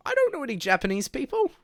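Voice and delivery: loud, very high squeal of protest; exasperated protest. Real, not acted. Streamer answering chat's advice, loud exasperated high protest.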